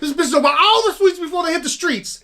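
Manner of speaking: loud, high-pitched opening, dropping at the end; movie character impression. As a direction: launch loudly into a quoted film rant. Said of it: "that's almost spot on, son".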